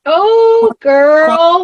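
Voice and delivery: high, loud, drawn-out gush; real excitement. Real, not acted. Gushing over cornbread with milk.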